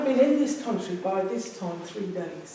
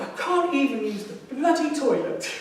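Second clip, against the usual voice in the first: distraught laughing; 6 semitones higher, 6 dB louder than usual. Retold or imitated. Retold distraught outburst, laughing.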